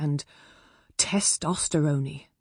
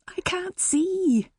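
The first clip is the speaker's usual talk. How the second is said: hushed, much higher whisper; slow breathless spy whisper; 13 semitones higher, slower than usual. A narrator's reading. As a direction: whisper it while spying through the curtain. Mum peering, whispering.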